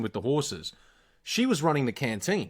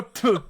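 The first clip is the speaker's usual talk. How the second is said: spit sound demo; 8 semitones higher, 5 dB louder than usual. Shown as a demonstration. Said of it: "if you go".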